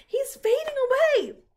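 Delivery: very high, loud cry of alarm; alarmed high-pitched scream. A narrator's reading. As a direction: scream it like a panicked girl. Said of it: "screamed Fern".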